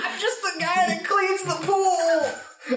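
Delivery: pool guy voice. Acted. Pool cleaner character revealed.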